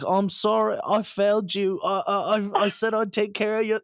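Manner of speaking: high, loud tearful plea; hammed-up grieving apology; mock tearful Alfred. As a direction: play a sobbing old butler, half-mocking. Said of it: "he's like".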